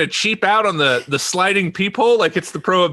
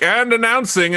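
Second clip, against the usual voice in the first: lightly voiced court herald, higher; royal ball announcer; 6 semitones higher than usual. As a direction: announce the guests in a raised herald's tone. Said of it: "like, royal balls when".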